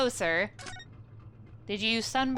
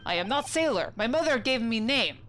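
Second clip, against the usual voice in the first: put-on proud, broken English with a Russian accent; Russian sailor accent. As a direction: announce your name like a stern Russian crewman. Sailor introduces himself.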